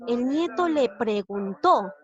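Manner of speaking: slow reading with a strong TÓ in "preguntó"; teacher's deliberate pregun-TÓ; stress on last syllable. Shown as a demonstration. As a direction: draw out "preguntó" and hit the final TÓ. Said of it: "¿Dónde lleva la mayor fuerza de voz?".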